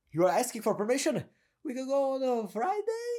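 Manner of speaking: high-pitched mock plea, "Friday" sliding up; questioning uptalk. Shown as a demonstration. Coach mocks people asking for permission, questioning uptalk. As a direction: raise "Friday" as if begging permission. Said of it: "go up with their sentence".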